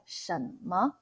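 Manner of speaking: 什么 said the Taiwanese way, 什 as a soft s; relaxed Taiwanese 什么 with a plain s; Taiwan-style 什么 with the s sound; Taiwanese accent. Shown as a demonstration. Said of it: "we actually pronounce more like".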